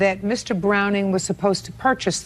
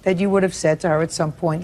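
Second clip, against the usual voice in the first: normal talking voice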